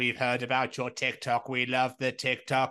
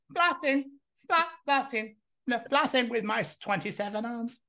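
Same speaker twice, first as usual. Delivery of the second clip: high, sing-song alien voice; mock alien; 11 semitones higher than usual. Pretending to be an invading alien, a high, silly alien voice. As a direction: play the alien showing off his weapons. Playing an alien with many arms.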